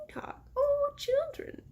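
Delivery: very high grandmother character voice; trying out grandmother's voice. Shown as a demonstration. Narrator hunting for the grandmother's voice, trying it high. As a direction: test a high-pitched granny voice. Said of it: "that doesn't sound like her voice".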